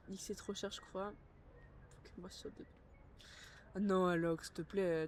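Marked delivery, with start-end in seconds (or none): none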